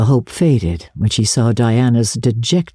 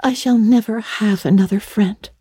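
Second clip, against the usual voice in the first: put-on higher, woeful girlish voice; Anne, tragic girl; 7 semitones higher than usual. A narrator's reading. Anne tells Marilla her friendship is over, tragic lament.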